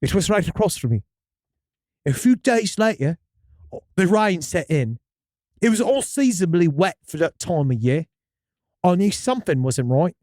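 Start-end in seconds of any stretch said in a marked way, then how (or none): 2.1-10.2 storyteller in a put-on British accent; hammy English-accented first-person tale. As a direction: read it with an amateur British accent. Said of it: "Can you stick with this accent".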